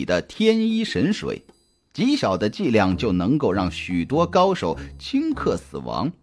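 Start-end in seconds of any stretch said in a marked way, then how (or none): none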